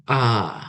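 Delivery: A low, dipping then rising; third tone. Shown as a demonstration. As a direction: let A sink, then lift it. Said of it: "agak turun baru naik".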